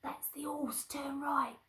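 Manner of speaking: high, hushed crook voice; soft, high storybook thief; sneaking thief. Acted. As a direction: hiss directions like a burglar. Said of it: "And Len said".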